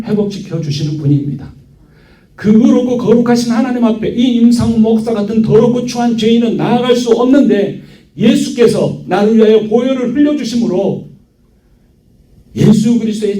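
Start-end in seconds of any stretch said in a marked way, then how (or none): 2.3-13.4 high-pitched, driving sermon delivery; impassioned preaching. Real, not acted. Pastor confessing himself a sinner before God, impassioned preaching. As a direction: proclaim it with sustained high intensity.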